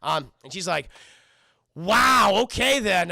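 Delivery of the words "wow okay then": loud, drawn-up "wow" of affront; mock taken aback. Girl reacting to his refusal, mock taken aback. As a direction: act out being put off, loudly. Acted offended reaction in retold dialogue.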